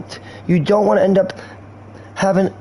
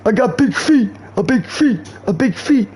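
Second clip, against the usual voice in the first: lightly put-on big galoot voice, pitched up; imitating the big guy; 4 semitones higher than usual. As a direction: mimic him chanting his feet. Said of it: "He was just like".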